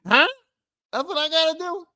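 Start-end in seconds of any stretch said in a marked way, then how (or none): 0.1-0.3 sharp disbelieving huh; stunned huh; incredulous huh. Real, not acted. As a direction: react like you can't believe it. Incredulous rant about boss.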